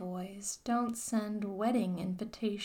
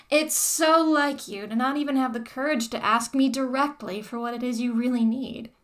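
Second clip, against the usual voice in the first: scornful taunt; 3 semitones higher, 9 dB louder than usual. Acted. Dominatrix taunting him.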